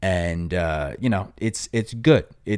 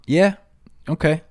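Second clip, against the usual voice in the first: ordinary delivery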